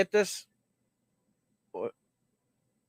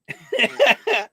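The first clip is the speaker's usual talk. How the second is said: laugh; 6 semitones higher, 5 dB louder than usual. Real, not acted. Host laughing.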